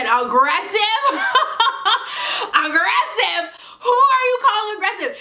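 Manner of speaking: high-pitched, laughing mock indignation; incredulous outrage. Woman retelling retort to neighbor who called her aggressive, incredulous.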